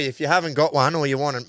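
ordinary delivery

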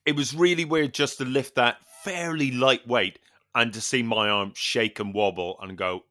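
ordinary delivery

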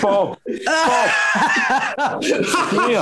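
calling the dog. Real, not acted. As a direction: call him over laughing.

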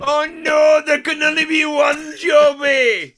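hammed-up Scottish wail. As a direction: holler it like a Scottish stand-up. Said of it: "[name] it up".

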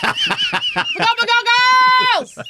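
put-on very high loud child wail; high loud kid yelling for mum; whiny kid yelling.

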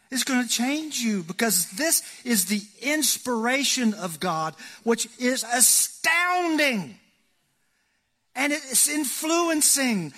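high, loud and driving, building to heaven coming down; full-force preaching. Real, not acted. Pastor urging the congregation to read the Bible, in full-force preaching.

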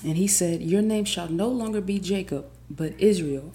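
loud, rising proclamation of the verse; dramatic Bible reading with building fervor; emphatic scripture reading. Acted. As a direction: stretch the questions and punch each phrase. Reads the Bible passage aloud.

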